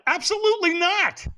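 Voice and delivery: high-pitched, indignant refusal; ticked off. Retold or imitated. Retelling his answer to the firewalk helper, ticked off. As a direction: refuse outright, voice jumping way up. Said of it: "now I'm kind of ticked off".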